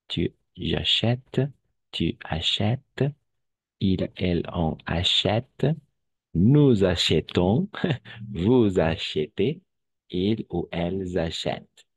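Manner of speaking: each form of acheter spelled out slowly; slow conjugation drill. Shown as a demonstration. Teacher drilling acheter conjugation slowly for students. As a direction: recite the conjugation slowly for the class. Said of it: "conjugation drill".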